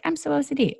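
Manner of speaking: very high-pitched mimicry; mock flippant. Speaker mimicking people who misuse a diagnosis, mock flippant. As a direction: mimic a casual boast in a high voice.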